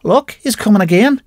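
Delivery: high, fearful woman's voice; frightened warning. A narrator's reading. Annie seeing the ghostly corporal, high frightened warning. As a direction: point him out in fear. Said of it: "she says".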